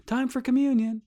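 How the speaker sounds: high singsong pastor calling out; high-pitched imitation of a pastor; pastor's announcement. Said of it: "every time the pastor says".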